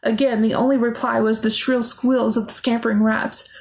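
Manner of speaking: ordinary delivery, nothing marked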